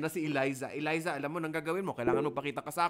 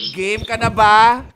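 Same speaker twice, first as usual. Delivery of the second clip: loud, slow, drawn-out rallying call; game-show host pumping up the room; hyped call-out; 5 semitones higher, 19 dB louder, slower than usual. Real, not acted. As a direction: wake the whole class up with it. Teacher hyping up sleepy class.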